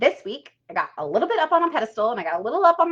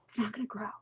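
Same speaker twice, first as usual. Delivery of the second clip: whispered aside; 13 dB quieter, much faster than usual. Acted. Stressing bad soil ruins growth.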